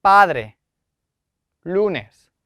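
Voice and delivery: padre and lunes, first syllable strong and falling away; stress on second-last syllable. Shown as a demonstration. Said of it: "강세를 줘서 읽으셔야 됩니다".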